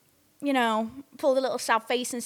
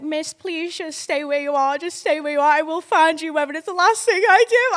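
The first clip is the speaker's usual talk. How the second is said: high, urgent promise building louder; urgent pleading; 6 semitones higher, 6 dB louder than usual. Retold or imitated. Uber driver promises to find crying passenger, urgent pleading. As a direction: mock-desperate plea, rising in pitch and volume.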